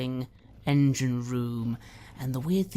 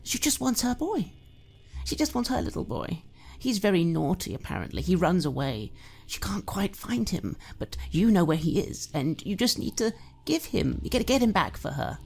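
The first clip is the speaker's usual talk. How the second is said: put-on high, wheedling drunk mother with sudden whispers; creepy doting mother; 6 semitones higher than usual. Mother wheedling her grown son Joshy, creepy doting voice. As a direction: coax your son sweetly, menace underneath.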